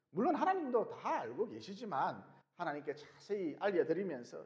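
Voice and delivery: usual speaking voice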